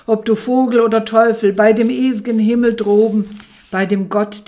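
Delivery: loud and fast, urgent pleading; crying out in doubt. A narrator's reading. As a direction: swear it at the bird in a rush. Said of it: "rief ich voll Zweifel". (lauter, hastiger Schwur)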